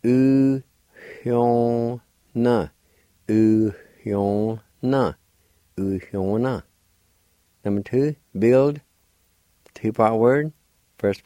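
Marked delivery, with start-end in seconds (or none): 0.0-6.6 U, hyun, na with a faint breathy H right after U; faint H sound. Shown as a demonstration. Teacher demonstrating Cherokee word for bugle, faint H sound. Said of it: "there's a faint H sound like this".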